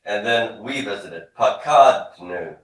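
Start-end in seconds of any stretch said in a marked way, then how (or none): 1.4-2.5 slow, loud, drawn-out syllables; repeat-after-me model. Shown as a demonstration. Teacher leading a class drill, slow loud model word. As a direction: sound it out for students to copy. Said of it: "Repeat after me".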